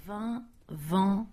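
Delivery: "Vin, vent" with two distinct nasal vowels; nasal vowel contrast. Shown as a demonstration. Learner practising "Vin, vent". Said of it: "Provence, Provence".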